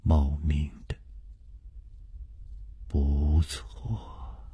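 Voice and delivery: low, soft murmur to himself. A narrator's reading. Chu Liuxiang murmurs to himself, low and soft. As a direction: murmur it under the breath. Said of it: "楚留香喃喃地说".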